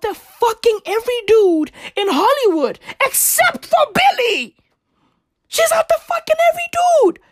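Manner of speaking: high, fast, shouting gossip; excited rant. Real, not acted. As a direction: build to an outraged yell.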